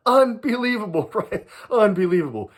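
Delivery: high, laughing "Unbelievable"; laughing disbelief. Real, not acted. Recalling his buddy's blunder, laughing in disbelief.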